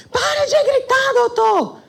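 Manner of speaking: very high, drawn-out mock scream; yelling. Friend telling judge to stop, high screamed imitation. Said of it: "Ela grita".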